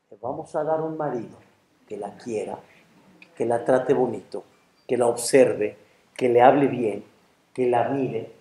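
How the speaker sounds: low, soft, soothing reply; calm angel. Acted. Angel telling man about his wife's next husband, calm. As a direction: list it gently and quietly, unmoved.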